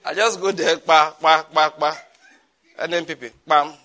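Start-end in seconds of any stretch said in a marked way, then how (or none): 0.8-2.0 loud stamping pa pa, beat by beat; mock stamping sounds. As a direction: bang out the stamps like a voter. Mimics stamping thumbprints on ballots.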